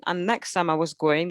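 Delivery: usual speaking voice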